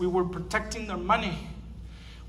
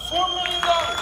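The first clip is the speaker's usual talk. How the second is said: higher, louder, thrilled exclamation; excited; 9 semitones higher, 5 dB louder than usual. Real, not acted. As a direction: shout the sum with delight. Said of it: "and raising more than four million dollars".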